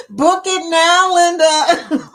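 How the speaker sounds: loud, slow, high commercial announcer voice; mock promo read, drawn out and loud; hammed-up ad pitch. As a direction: sell it like a TV ad spot. Doing a commercial promo line.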